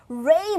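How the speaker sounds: 레이 as English "ray"; English r gliding into "ay"; English pronunciation. Shown as a demonstration. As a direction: say 레이 with a rounded English r. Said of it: "막 이런 거 없애버리고".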